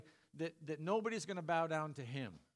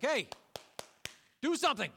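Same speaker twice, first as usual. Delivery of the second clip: high, loud mock prodding; rousing shout; 6 semitones higher, 6 dB louder than usual. God to people standing with jaws open, high acted shout. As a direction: jolt gaping listeners into action.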